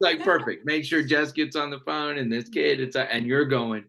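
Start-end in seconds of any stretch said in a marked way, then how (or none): 0.2-2.8 higher, louder, pleased divine voice; playful God voice. Pretend. Host voicing God arranging the call, playful and pleased. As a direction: play God lining it up, lightly.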